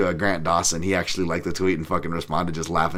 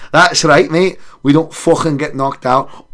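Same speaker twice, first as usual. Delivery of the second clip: mock Scottish voice, a bit higher; playful hint of a Scottish brogue; lightly put-on Scottish accent; 6 semitones higher, 6 dB louder, slower than usual. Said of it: "why did you turn Scottish there?".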